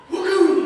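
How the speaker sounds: high, loud pained whoop. Retold or imitated. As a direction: yelp as the finger gets crushed. Finger crushed in car.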